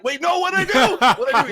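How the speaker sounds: high, loud mock alarm; retold panicked protest. Guest retells protesting to the host, loud mock panic. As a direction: blurt it out in exaggerated dismay.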